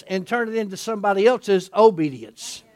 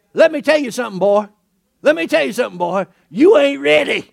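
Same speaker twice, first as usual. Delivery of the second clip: put-on louder, folksy Southern scolding of a boy; country way; 3 semitones higher, 7 dB louder than usual. Jesus telling Peter off in a country way, folksy Southern scold. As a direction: talk down to him like a country elder. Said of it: "Can I put it in a country way?".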